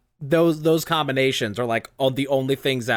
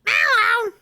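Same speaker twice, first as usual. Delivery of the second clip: much higher, louder squeaky cartoon meow; 5 dB louder than usual. Showing the co-host her high Mario game character voice. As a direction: pop out a tiny Mario-game critter voice. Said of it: "have to do the voice of Peach".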